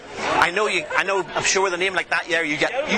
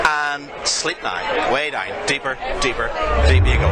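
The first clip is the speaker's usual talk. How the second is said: slow, low hypnotic voice; hypnotic induction; 6 semitones lower than usual. Acted. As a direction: lull her down into sleep.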